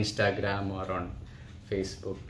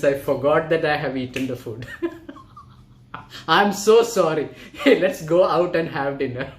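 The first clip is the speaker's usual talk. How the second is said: louder, laughing through a sheepish apology; 4 semitones higher, 6 dB louder than usual. Acted. Scientist apologises to his friend, laughing and louder. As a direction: giggle your way through saying sorry. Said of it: "scientists go like".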